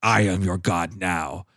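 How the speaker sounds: low, slow growling god voice; bear voice. Retold or imitated. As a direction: rumble it like a bear that learned to talk. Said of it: "his voice becomes basically like ... if a bear could vocalize".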